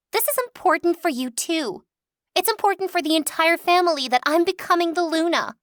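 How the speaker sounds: put-on high, whiny girly voice; whiny spoiled sister. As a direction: play the spoiled little sister.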